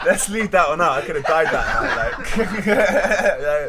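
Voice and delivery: high, loud, laughing through the words; real helpless laughter, words squeezed out; laughing hard. Real, not acted. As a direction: crack up while waving the story away. Laughing over off-limits story.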